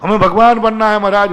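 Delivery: high, loud mimicked plea; loud, high mock petition; eager devotee. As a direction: imitate an over-eager follower calling to the guru. Mimics devotees coming to the guru.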